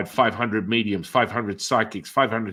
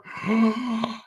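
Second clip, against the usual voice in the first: high gasp of amazement; amazed gasp; 12 semitones higher than usual. Retold or imitated. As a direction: gasp as if seeing a miracle. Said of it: "their friends are like".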